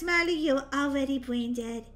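usual speaking voice

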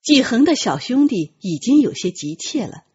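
plain reading voice

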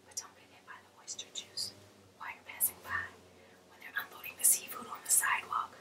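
whispered aside, voice dropped to nothing. Real, not acted. Warning viewers about oyster juice on the sidewalk, in a whisper. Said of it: "whispered aside".

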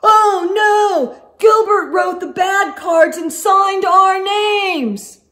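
high, loud alarmed shout; boy shouting the discovery to the class. Acted. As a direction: cry out in dismay. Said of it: "Then he shouted".

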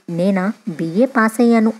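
usual speaking voice

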